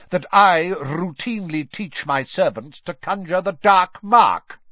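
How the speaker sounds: icy, rising anger; cold anger. Acted. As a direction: bite every syllable coldly. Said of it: "cold anger in every syllable".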